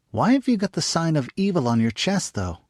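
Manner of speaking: put-on high, eager little girl; young girl voice. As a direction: pitch it up like a curious young girl. Narrator voices young girl Jane.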